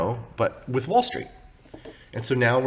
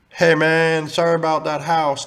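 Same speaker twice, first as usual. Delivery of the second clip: louder, raised mock commenter voice; mock commenter call-out; 4 semitones higher, 7 dB louder than usual. Telling viewers what to write in comments, mock call-out. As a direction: call it out like a pretend fan's comment.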